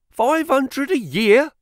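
high, drawn-out incredulous cry; astonished shout. A narrator's reading. Young clerk hearing the salary offer, astonished shout. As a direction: shout it in disbelief, pitch leaping up. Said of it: "I shouted".

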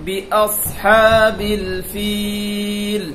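বিআসহাবিল with heavy সাদ and long ফিল; slow tajweed reading. Shown as a demonstration. Teacher models the verse ending for pupils. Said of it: "বিআস, এটা সাদ".

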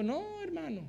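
calm the worried believer softly; Holy Spirit answering the believer's prayer, soft and reassuring. Retold or imitated. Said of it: "el Espíritu está diciendo".

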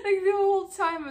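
high, loud and tearful with laughter; choking on laughter; laughing to tears; crying laughing. Real, not acted.

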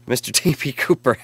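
laughing through the donor's name. Real, not acted. Host laughing while reading the donor's name, laughing. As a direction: chuckle out the name mid-laugh.

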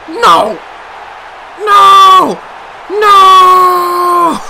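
loud, high shouts of no; anguished shouts. Real, not acted. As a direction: yell in despair at the screen. Play going wrong.

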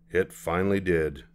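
plain reading voice

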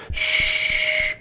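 long unvoiced "shh" of air; imitated hiss. Survivor opening an air mask, imitated hiss. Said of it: "I hear that, yeah".

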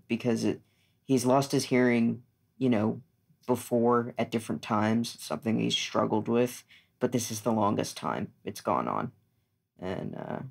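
normal talking voice

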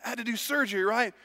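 put-on high, eager little boy voice. Young son quizzing dad about surgery, high and eager. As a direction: play the excited young son pestering dad. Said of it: "his son said".

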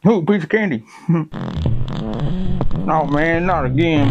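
delighted then dismayed; excited old man. Acted. Character finds candy, disaster.

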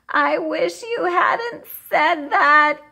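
put-on slow, high, put-upon groan of a child. A narrator's reading. Camila groans at the therapist's advice, slow and high. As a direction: drag it out like a fed-up kid. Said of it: "Camila groaned".

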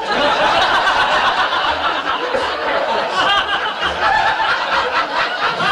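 high breathy cackle; villain cackle. Acted. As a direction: cackle with glee like a baddie. Fairy or villain laughing.

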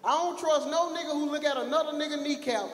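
ordinary talk, nothing marked

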